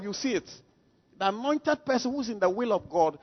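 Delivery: ordinary talk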